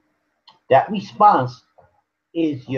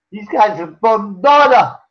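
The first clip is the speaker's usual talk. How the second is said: put-on Jamaican accent; 6 semitones higher, 5 dB louder than usual. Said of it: "Like they say in Jamaica".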